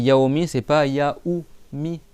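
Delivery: "yaomi" with a closed w glide, "yaoumi" with a full ou; yaomi versus yaoumi. Shown as a demonstration. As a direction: slowly contrast "yaomi" and "yaoumi". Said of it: "c'est yaomi".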